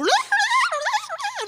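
squealing laugh; high burst of laughter. Real, not acted. Preacher laughing at the fleeing man, high.

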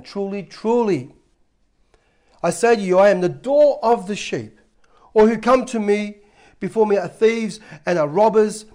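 scripture reading. Acted. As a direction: read it out to the congregation with weight.

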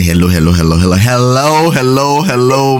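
a lightly hammed-up imitation of his own hellos; mocking own greeting habit. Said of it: "She has complained. She said I always say".

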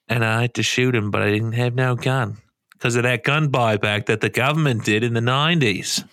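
hammed-up Aussie yarn-spinner accent; Australian accent. Co-host spinning a mock night-out yarn, Australian accent. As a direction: tell it as a broad Australian tall tale. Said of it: "really good Australian accent".

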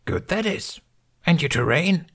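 put-on high, breathy little old master; lightly voiced Yoda. A narrator's reading. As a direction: croak it out like a tiny ancient sage. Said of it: "Yoda smiled".

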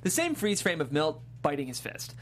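ordinary talk

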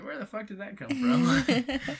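high, slow, laughing disbelief; startled disbelief. Retold or imitated. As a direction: laugh out a surprised question. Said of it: "And I'm just like".

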